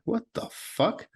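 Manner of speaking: high, slow disbelieving cry; shocked. Retold or imitated. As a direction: say it like you just caught yourself off guard. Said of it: "I started getting tears in my eyes".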